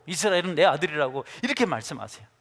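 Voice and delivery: ordinary talk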